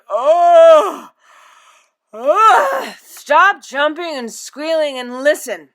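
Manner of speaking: loud yawning groan into slow scolding; yawning, exasperated. A narrator's reading. Sleepy father to squealing daughter, exasperated groan. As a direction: groan awake, then order slowly. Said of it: "Mister Russell turned over and yawned".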